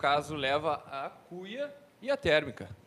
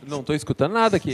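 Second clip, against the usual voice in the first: loud; 3 semitones lower, 9 dB louder than usual. Real, not acted. Guest can't hear headphones.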